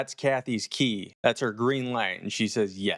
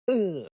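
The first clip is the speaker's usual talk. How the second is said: high, falling groan of disgust; disgusted ugh; 8 semitones higher than usual. Real, not acted. Reviewer describing skin-crawling horror, disgusted. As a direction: shudder out a higher-pitched ugh.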